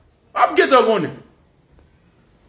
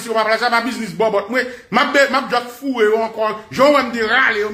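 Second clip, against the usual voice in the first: ordinary talk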